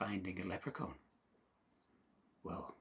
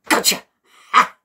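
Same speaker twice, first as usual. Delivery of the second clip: sudden shout; 13 semitones higher, 24 dB louder than usual. Acted. Seamus pounces on the leprechaun, sudden triumphant shout. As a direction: burst out loud after the hush.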